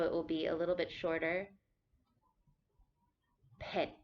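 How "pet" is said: Pet short and low. Shown as a demonstration. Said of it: "this is a low tone".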